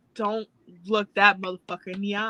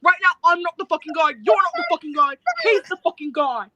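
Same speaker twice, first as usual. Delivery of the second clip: raised, louder mock British insistence; Ospreay impression; 6 semitones higher, 5 dB louder than usual. Co-host doing Ospreay for viewers, British accent. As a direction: keep the hammed Ospreay voice going. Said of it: "an Ospreay impression".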